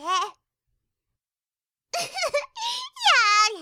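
a burst of high, loud teasing laughter; loud mocking laugh. Sister laughing at her brother's loud plea, loud and high. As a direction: laugh right at him, delighted.

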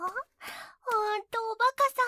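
ordinary talk

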